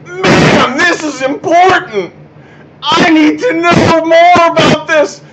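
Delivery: high, loud, slow mock awe; mock excited reader. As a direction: shout it like a thrilled reader. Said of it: "read anything you wrote and say to themselves".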